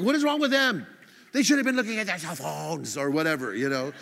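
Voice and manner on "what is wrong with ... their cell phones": raised, higher mock-angry outburst; mad. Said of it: "I'm all mad".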